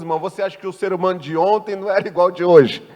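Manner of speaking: usual speaking voice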